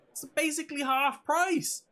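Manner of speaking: high excited sales pitch; hyped ad shout. Acted. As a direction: sell it loud and high.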